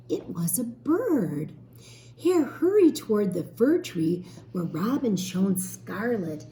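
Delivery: put-on lively storybook reading for children; teacher reading a story to young children, gently expressive; story narration. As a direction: read it like a picture book aloud to a class.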